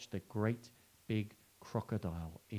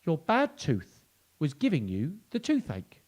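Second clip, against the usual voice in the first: mouse; 5 semitones higher, 5 dB louder, slower than usual. Acted. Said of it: "answered the mouse".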